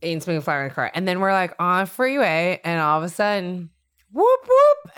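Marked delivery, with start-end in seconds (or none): 4.1-4.9 high, loud whoop-whoop siren; police siren imitation. Telling how the cop pulled them over, loud siren imitation. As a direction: sound like the cop car lighting up.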